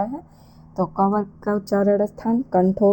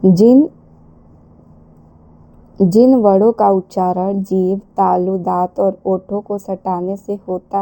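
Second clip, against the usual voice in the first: emphatic definition; 7 dB louder than usual. Real, not acted. Teacher stresses definition.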